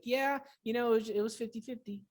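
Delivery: lightly voiced coach's quick reply; retold coach. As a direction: imitate a coach brushing it off. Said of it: "He's like".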